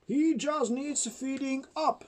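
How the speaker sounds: daddy dinosaur. A narrator's reading. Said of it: "And the daddy said".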